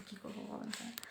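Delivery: breathy whisper, half to herself; whispered aside. Real, not acted. Teacher joking to a viewer about makeup, whispering. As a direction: drop to a whisper for the joke.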